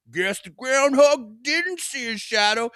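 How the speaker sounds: high, slow, hammy small-talk dad; neighbor dad voice. Retold or imitated. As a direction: chirp it like a cheery neighbor. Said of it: "You're like".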